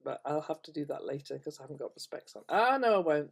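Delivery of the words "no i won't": normal talking voice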